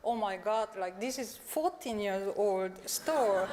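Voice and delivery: ordinary talk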